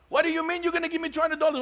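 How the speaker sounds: high, fast, incredulous; hammed-up disbelief at the full price; mock outrage. Shopkeeper baffled the customer pays full price, mock outrage.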